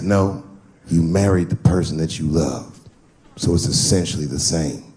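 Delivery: low, measured correction; imitated buddy, pitched well down; friend's voice.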